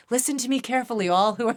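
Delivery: playful mock oracle addressing all; mock proclamation. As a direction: grandly address the heavens, then crack up. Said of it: "I keep looking up, like, I'm like".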